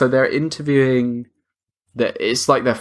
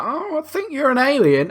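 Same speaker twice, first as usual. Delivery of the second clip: high-pitched knowing accusation; mock suspicion; 12 semitones higher than usual. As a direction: accuse them in a high, teasing voice. Acts out accusing the alien.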